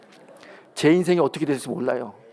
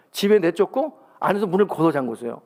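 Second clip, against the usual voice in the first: ordinary talk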